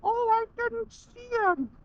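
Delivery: put-on high, slow, clueless old driver voice; whiny put-on voice of an oblivious boomer; mocking old driver.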